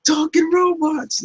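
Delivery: high-pitched, freaking-out dude voice; Mikey. Pretend. Turtle sees robots and would flip out, excited high squeal. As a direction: draw it out high, mock-amazed. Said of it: "Mikey would flip the hell out".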